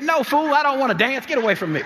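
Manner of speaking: high, loud brush-off; scolding retort. Retold or imitated. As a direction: mom shutting down a pushy stranger. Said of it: "She said".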